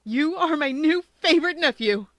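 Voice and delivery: overjoyed uncle to nephew; happy tears. A narrator's reading. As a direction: gush with joyful emotion. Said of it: "burst into happy tears".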